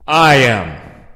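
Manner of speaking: loud, booming "I am"; forceful declaration. Acted. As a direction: declare it with full authority. Judge announces himself to accused.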